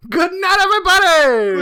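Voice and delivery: loud high sign-off yell; excited shout. Acted. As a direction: shout good night with glee.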